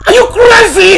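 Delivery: high, loud incredulous shout; outraged yell. Acted. Husband challenging threatening wife, outraged yell. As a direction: fire the question out in fury.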